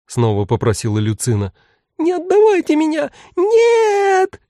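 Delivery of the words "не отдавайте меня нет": much higher, loud frantic shriek; squealing creature crying no. A narrator's reading. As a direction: shriek in terror. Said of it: "истошно верещал манекен".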